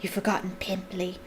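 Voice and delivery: soft, spiteful taunt; quiet, needling jibe; hissed taunt. A narrator's reading. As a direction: hiss it slyly into her ear. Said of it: "Peeves hissed in her ear".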